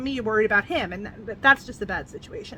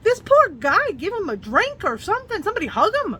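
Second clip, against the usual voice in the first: high, loud cry of pity for a character; exclaiming in pity; 10 semitones higher, 7 dB louder than usual. Retold or imitated. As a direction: burst out with sympathy for him. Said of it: "And I'm like".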